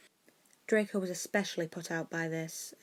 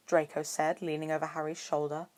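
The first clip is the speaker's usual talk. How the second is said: ordinary delivery, nothing marked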